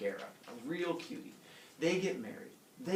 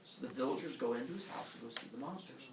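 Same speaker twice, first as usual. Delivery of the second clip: ordinary talk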